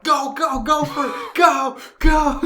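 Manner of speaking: high, loud urging shouts; cheering on. Retold or imitated. Viewer rooting for mother slapping Naoka, shouting excitedly. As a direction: egg the fighter on, shouting.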